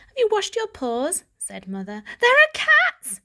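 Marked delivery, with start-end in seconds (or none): 0.1-1.2 high fussing mother voice; doting mother checking on her kitten; fussy mother. Acted. 2.2-3.2 loud high slow shout of alarm; urgent shouted warning. Acted. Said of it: "shouted Varjak".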